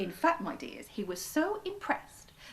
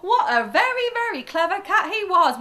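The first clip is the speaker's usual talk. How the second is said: higher, louder with a laugh; delighted; 10 semitones higher, 12 dB louder than usual. Real, not acted. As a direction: laugh with delight at the clever cat. Teller delighted at story's end.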